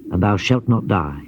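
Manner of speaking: ordinary delivery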